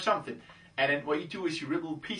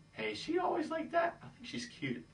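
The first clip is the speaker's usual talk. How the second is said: very high, soft teasing voice; soft squeaky question fading to near whisper; playful aside; 7 dB quieter than usual. Acted. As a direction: tease in a high voice, then drop to a hushed aside. Mock aside to her girlfriends.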